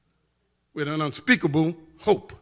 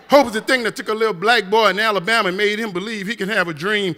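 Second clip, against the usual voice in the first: rousing preaching finish; 3 semitones higher, 6 dB louder than usual. Acted. Closing hope refrain.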